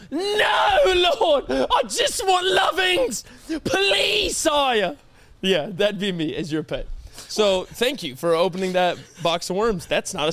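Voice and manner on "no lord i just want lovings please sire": whiny pet voice. Pretend. Pet begging owner for food, high whiny plea. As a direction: plead like a starving little pet to its master. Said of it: "And I go".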